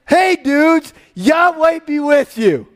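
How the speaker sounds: high, loud call to the work crew; big drawn-out hello, called across a field; hearty greeting. Acted. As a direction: hail the guys like an upbeat boss. Boaz voiced greeting his workers.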